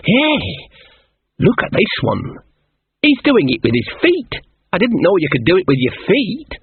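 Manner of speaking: high-pitched, amazed innocent; wide-eyed naive Shawn. Acted. Shawn looks through the king's book, amazed. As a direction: marvel at it innocently.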